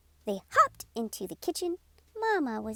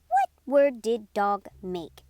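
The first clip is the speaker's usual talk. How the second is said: usual speaking voice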